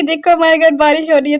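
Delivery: teasing the cat. Retold or imitated. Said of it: "मैं इसको चिढ़ा रही थी".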